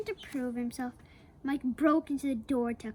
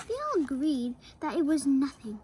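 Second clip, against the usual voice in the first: plain reading voice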